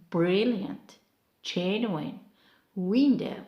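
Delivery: CLEV-er, SI-lent, WIN-dow, front of each word punched; first-syllable stress. Shown as a demonstration. Teacher modelling two-syllable words, stressed on the first. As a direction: hit the first syllable of CLEVER through WINDOW, then drop. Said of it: "we mark stress on the first".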